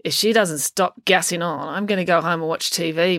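ordinary delivery, nothing marked

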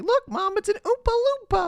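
very high mock child voice; squeaky small boy calling to Mom; little boy. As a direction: pipe up like an excited kid. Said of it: "a little boy with his mom".

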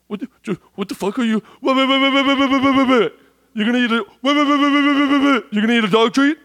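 panicked big brother cutting in over and over; escalating shocked yell as Jake; brother Jake freaking out. Retold or imitated. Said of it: "Let me do his voice".

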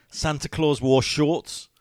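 ordinary delivery

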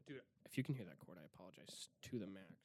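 normal talking voice

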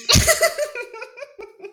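high delighted laugh; burst of laughter. Real, not acted. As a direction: laugh out at the match. Matching quote success.

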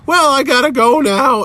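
high mock-breezy sign-off; abrupt polite exit. Interviewer bails on annoyed Mignola, mock breezy. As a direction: pitch it way up, making excuses to leave.

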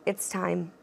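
ordinary delivery

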